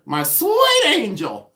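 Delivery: very high, drawn-out screech; Pamela Blanchard. Retold or imitated. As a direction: stretch it into a slow, high screech, imitating a friend. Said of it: "she screeches and she goes".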